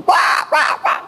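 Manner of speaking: high unvoiced shouted calls; child shouting for father at home; retold loud shout. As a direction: call out sharply, high and forced. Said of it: "teriak kenceng".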